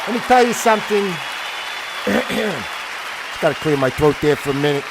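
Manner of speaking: a wound-up local boss airing grievances; upset Vincenzo. Acted. Host plays guest Vincenzo.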